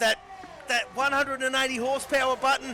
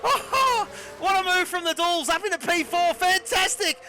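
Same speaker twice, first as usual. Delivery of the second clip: excited commentary; 5 semitones higher than usual. Real, not acted. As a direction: call the overtake at full thrill. Double overtake called live.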